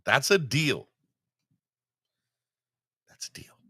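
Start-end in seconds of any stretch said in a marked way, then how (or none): 3.1-3.6 whispered under the breath. Real, not acted. Host whispering the deal to himself, hushed. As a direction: breathe it out almost unheard.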